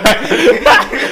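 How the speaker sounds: high burst of laughter; loud laugh. Real, not acted. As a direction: crack up loudly. Laughing at own chant.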